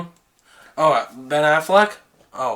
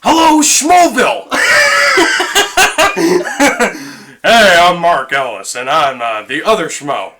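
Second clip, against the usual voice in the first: shouted host greeting; 4 dB louder than usual. Retold or imitated. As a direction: greet the crowd at full volume.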